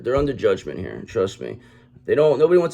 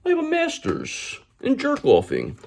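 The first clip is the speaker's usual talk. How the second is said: much higher, put-on squeaky voice of a pompous phony; mock indignant fraud. Tarot reader mocking the Emperor in Reverse, high squeaky indignant voice. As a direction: ham up an offended fake acting innocent. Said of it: "That's how they talk".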